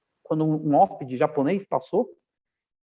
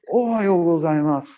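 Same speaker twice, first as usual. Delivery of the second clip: slow, serious Japanese guest imitation; hóspede japonês sério; 4 dB louder than usual. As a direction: draw the greeting out solemnly, playing the startled guest. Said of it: "Aí fez sério, assim". (imitação de japonês sério, saudação arrastada)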